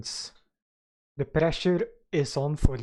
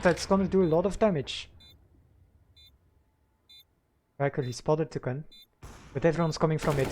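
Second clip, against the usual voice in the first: live play-by-play commentary; esports caster. Real, not acted. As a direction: call the match round by round.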